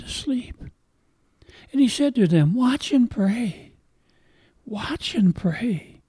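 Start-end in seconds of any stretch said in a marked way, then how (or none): 2.5-6.0 drawn out, higher pleading urge; slow urging. Acted. Jesus waking the sleeping disciples, slow imploring urge. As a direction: stretch each word slowly, imploring.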